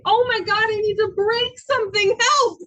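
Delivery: loud, high, frantic yell; raging plea for help. Acted. Telling a loved one about rage, loud frantic outburst. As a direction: explode in rage, begging for help. Acting out rageous feelings.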